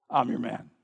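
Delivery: low, settled grown-man declaration; grown man's voice. Shown as a demonstration. Big grown-up warrior volunteering for battle, low and calm. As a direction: say it deep and understated, like a grown warrior. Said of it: "Only it would sound more like".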